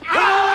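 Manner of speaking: short shouted battle yell; battle cry. Acted. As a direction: let out a sharp war cry. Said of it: "demonstrate a Marine Corps battle cry".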